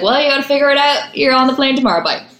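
high, hurried send-off in a mimicked voice; imitated dismissive agent. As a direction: play the agent brushing off a client. Acts out agent brushing her off.

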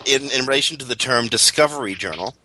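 ordinary delivery

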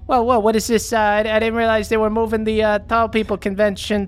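put-on high, bratty teenage kid talking back; mouthy teen. Teen kid talks back to his mom, high and bratty. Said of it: "and he goes".

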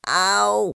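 high loud ow; cry of pain. A narrator's reading. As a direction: yelp as he's scrubbed. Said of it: "cried Piglet".

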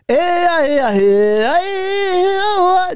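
high yelping ad libs; ad-lib yelps. Retold or imitated. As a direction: throw out rapper ad libs. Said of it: "So if I was like".

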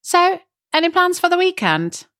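high, singsong imitation of small talk; chirpy small talk. Phone agent making weekend small talk, high imitation.

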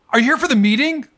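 playing the eager older writer at the elevator; older woman's voice. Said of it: "and she goes".

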